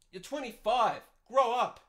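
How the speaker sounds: loud, high telling-off; angry scolding. Real, not acted. Host berating a twenty-five-year-old living at home, angry scolding.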